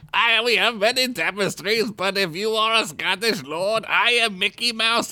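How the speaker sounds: high, indignant mock-Scottish quote; Scottish film impression. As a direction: act out the line in hammed-up Scottish. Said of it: "he's like the Scottish".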